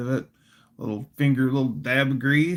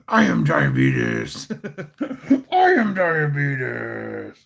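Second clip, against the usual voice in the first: spoof Batman voice; slower than usual. Retold or imitated. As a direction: growl it, cracking up midway. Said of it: "There's a spoof on Ba".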